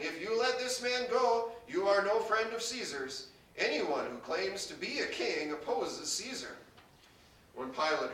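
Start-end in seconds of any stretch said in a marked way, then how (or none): 0.0-6.6 raised, higher-pitched crowd protest; mild narrated shouting. A narrator's reading. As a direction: voice a crowd's warning, moderately raised.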